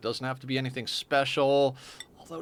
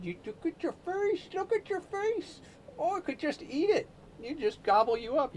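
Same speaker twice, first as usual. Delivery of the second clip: much higher, softer doting pet voice; 4 dB quieter than usual. Real, not acted. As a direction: gush over the kitty's face, high and soft. Cooing over the cat.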